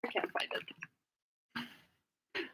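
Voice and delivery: breathy whisper, very slow. Real, not acted. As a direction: whisper it to yourself, defeated. Lost the question.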